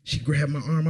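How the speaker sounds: ordinary talk, nothing marked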